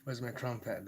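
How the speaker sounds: ordinary talk, nothing marked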